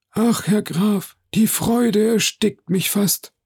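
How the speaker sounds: high, drawn-out effusive delivery; overcome with joy. Acted. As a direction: gush slowly, as if choked by happiness. Major spielt dem Grafen Vaterglück vor. (überwältigt vor Freude, hoch und gedehnt)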